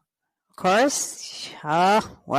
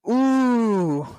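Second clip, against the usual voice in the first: usual speaking voice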